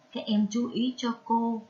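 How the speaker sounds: ordinary talk, nothing marked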